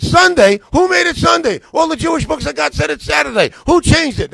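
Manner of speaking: high, loud, incredulous outburst; replaying the confrontation at full volume; indignant retold shout. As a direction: demand answers, fired up and loud. Retold angry challenge to church leaders.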